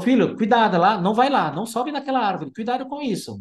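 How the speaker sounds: fretful mom warning her son off the tree; overprotective mother. Retold or imitated. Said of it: "e a mãe toda falou". (mãe superprotetora aflita, voz mais aguda)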